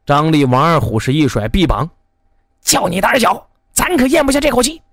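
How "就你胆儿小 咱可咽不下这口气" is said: high, hurried indignant retort; indignant bravado. A narrator's reading. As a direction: scoff at the coward, pitched up and quick. Defiant men itching to fight.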